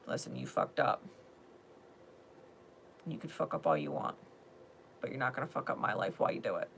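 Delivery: low, soft, firm acted talk; calm boundary-setting. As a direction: set the boundary softly, without anger.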